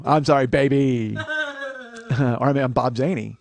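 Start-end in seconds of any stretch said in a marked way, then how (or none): 0.0-1.2 slow, slightly louder catchphrase delivery; lightly put-on TV detective; Kojak. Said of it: "I mean, I'm Kojak". 1.2-2.1 high, soft giggle; quick high-pitched chuckle at own joke; laugh. Real, not acted.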